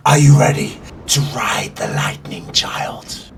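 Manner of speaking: low, whispered divine challenge; hushed, ominous voice tempting a disciple; storm deity. Acted. Storm deity answers the heroine.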